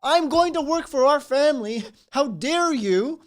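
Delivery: loud, puffed-up outrage, slowing on how dare you; hammed-up angry husband; mock indignant shout. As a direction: bellow like an offended provider.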